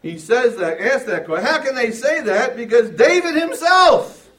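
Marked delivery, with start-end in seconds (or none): 1.5-4.3 "himself" punched high and loud; Jesus pressing his point to the crowd, stressing himself. Shown as a demonstration. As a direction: lean hard on "himself". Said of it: "notice the emphasis there is on himself".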